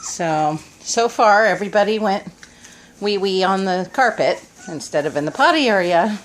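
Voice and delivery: ordinary delivery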